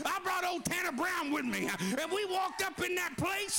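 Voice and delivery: rising, shouted preaching cadence. Acted. Preacher stirring up his congregation, rising shout. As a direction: preach it out with building fire. Preacher builds to full force.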